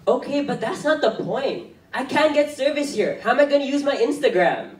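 high, loud complaint; exasperated whining. Acted. Complaining to the group about no phone service, exasperated. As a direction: whine in rising exasperation.

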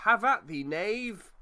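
loud hammy swashbuckler challenge; stagey fake archaic declaiming. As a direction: declaim it like an old pirate film. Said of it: "I heard it in a film once".

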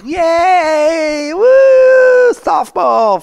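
very high, loud mock cheer; hammed-up squealing enthusiasm; fake cheering. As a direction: whoop like a phony sports parent. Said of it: "you guys are fake".

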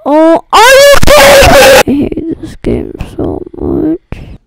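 high frustrated cry, then slow; raging gamer. Real, not acted. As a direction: wail in frustration, then drag it. Eaten in game, cries out.

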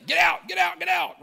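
shouted high and loud, half joking; mock shout. Pastor jokingly yells at guests who overstay. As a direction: yell at lingering guests to leave. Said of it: "instead of just saying".

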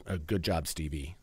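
lightly voiced dad, quietly approving; imitating his dad. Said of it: "I can hear my dad saying".

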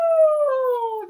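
high loud rooster call; high loud cock-a-doodle-doo; rooster crow. Acted. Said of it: "That's what we call a rooster".

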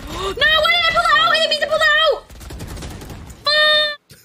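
very high, loud wail of regret; anguished shout. Real, not acted. Player yelling at herself for pulling out, anguished shouting. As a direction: scream at your own blunder. Anguished outburst at game mistake.

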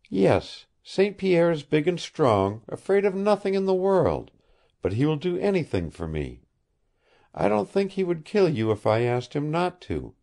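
put-on earnest high young woman, pleading and honest; lightly voiced heroine, innocent and earnest; young woman's voice. A narrator's reading. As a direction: speak like a puzzled, sincere girl.

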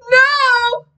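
high, loud cry of refusal; desperate shout. A narrator's reading. Narrating boy refuses to keep playing, high desperate shout. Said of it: "I shouted".

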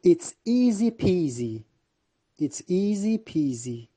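slow, sing-song easy peasy; slow playful model. Shown as a demonstration. Teacher slowly modelling a playful idiom for students. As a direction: draw out the rhyme for learners. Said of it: "teacher models phrase slowly".

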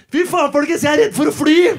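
loud, high, laughing announcement; loud opening joke. Retold or imitated. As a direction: belt the joke at the bus. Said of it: "snur meg i den bussen og sier". (høyt, lattermildt utrop)

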